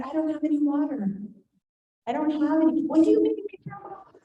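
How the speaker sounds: high-pitched back-and-forth, pleading and demanding; acted argument with screaming child. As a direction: act out a heated spat with a kid. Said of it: "he finally started screaming at me".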